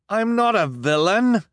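high, loud frantic denial. Acted. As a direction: deny it in panic. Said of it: "Jacques said frantically".

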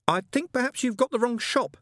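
high-pitched, polite protest; flustered politeness. Acted. Angel tells raging witchfinder wrong shop, flustered.

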